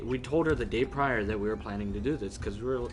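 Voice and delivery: ordinary talk, nothing marked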